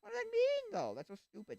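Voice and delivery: high, loud outraged imitation; shrieking complaint in a put-on voice; mock indignant questioner. Attendee complaining about the pastor, high outrage. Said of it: "It's like".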